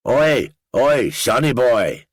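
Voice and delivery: put-on high, loud Northern Irish accent calling out; Danny calling out. A narrator's reading. Danny hailing the groggy narrator at Dublin airport, loud Northern Irish call. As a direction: hail him cheerily in a thick Northern Irish accent. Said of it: "Danny called to me".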